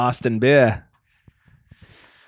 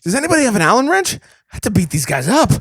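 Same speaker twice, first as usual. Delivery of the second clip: higher, fast hammed-up urgency; mock hero; 9 semitones higher, faster than usual. He echoes his cohost, hamming the hero's demand. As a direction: echo the call with mock panic. Echoing the bit.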